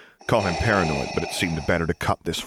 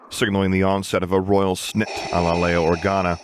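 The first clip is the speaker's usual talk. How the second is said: usual speaking voice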